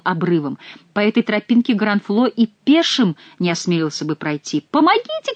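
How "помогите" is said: high panicked cry; cry for help. A narrator's reading. Monk on the cliff path cries for help, panicked. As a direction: shriek for help in fright. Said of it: "кричал монах".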